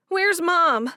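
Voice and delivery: high, loud and anxious; desperate to know; urgent worry. A narrator's reading. Frantic after death news.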